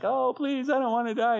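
high-pitched mock begging; acted pleading. Hezekiah begs for his life.

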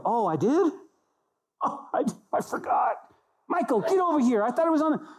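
high-pitched comic voice of someone caught forgetting; hammed-up dismay, voice shooting way up; mock flustered surprise. Preacher mocks a God who forgot his promise, flustered surprise.

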